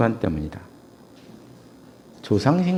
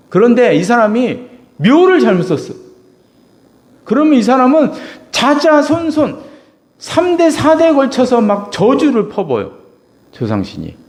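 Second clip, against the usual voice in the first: loud, high preaching cry; full-force preaching; 11 semitones higher, 6 dB louder than usual. Acted. Preacher crying out to his congregation, loud and high. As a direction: preach it out to the back row.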